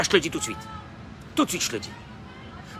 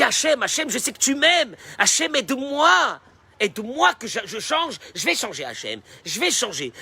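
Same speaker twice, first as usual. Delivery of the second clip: pleading prayer; 8 semitones higher than usual. Acted. Praying aloud to God.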